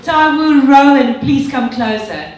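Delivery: normal talking voice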